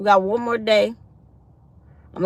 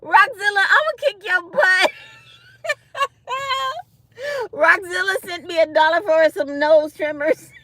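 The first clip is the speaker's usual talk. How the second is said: words squeezed out through laughter, higher and louder; laughing while talking; 9 semitones higher, 3 dB louder than usual. Real, not acted. Laughing at a viewer's joke donation. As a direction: talk through real laughter.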